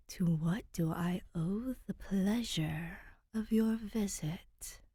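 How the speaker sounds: slow, airy whisper from the dark; soundless ghost voice. Acted. City ghost to the visiting princess, a slow spectral whisper. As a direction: breathe it like a thought in someone's head. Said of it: "a shiver in her mind".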